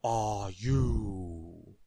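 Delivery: loud, drawn-out giant's roar; thundering giant. A narrator's reading. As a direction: boom it out like a huge giant. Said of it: "thundered the giant".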